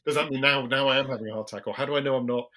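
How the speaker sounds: loud, frantic, rising alarm; panicked worry. Retold or imitated. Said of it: "asking yourself loads of "what if" questions".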